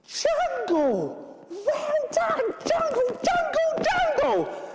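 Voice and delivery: high, jubilant repeated cheer; delighted exclaiming. Acted. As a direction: celebrate the children's right answer. Delighted the audience solved clue.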